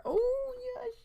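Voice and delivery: very high, soft cooing squeal. Real, not acted. Streamer cooing at her dog lying legs up, very high squeal. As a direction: coo at the puppy.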